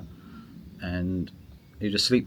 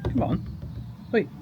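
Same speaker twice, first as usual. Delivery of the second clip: much higher, coaxing pet voice; talking to the cat; 5 dB quieter than usual. Real, not acted. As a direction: coax the cat in a high cooing voice. Calling the cat.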